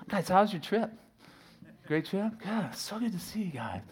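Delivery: high, falsely sunny greeting; fake cheerful. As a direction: pretend all is fine with the kids. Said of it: "pretend like it was righteous anger".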